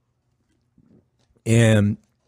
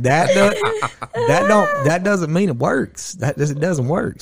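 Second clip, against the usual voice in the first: higher, half-laughing protest; laughing disbelief; 8 semitones higher, slower than usual. Retold or imitated. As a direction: laugh off a ridiculous excuse. Disbelief at the friend's excuse.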